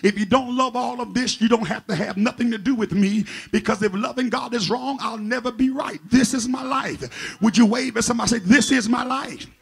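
loud, driving sermon cadence; preaching at full force. Acted. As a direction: preach it hard to the congregation.

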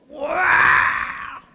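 put-on a sudden high, loud baby wail; piercing infant cry at the door; baby's wailing scream. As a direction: burst out crying like an infant at bedtime. Baby screams as mother leaves.

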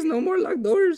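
quoting a clip to friends, high and squeaky; squeaky high quote. Pretend. Repeating the catchphrase.

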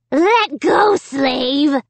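high, loud dummy squeal. A narrator's reading. Pinned dummy ordering Amy to release him, squealing. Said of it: "he squealed".

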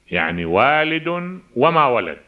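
slow reading that leans on "وما" as a negation; stress on وما. Shown as a demonstration. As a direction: press "وما" and let "ولد" fall. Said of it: "آه، نافية". (تشديد بطيء على "وما" النافية)

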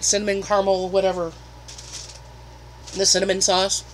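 eating between words; chewing while talking. Real, not acted. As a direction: mouth partly full. Seen 0: Holds pizza slice throughout, bites near 5.8, mouth appears chewing.

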